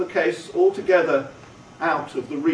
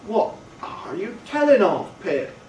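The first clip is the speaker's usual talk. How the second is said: amazed cry; 5 semitones higher than usual. A narrator's reading. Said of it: "cried Joe, falling back in the greatest amazement".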